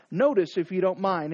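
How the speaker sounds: usual speaking voice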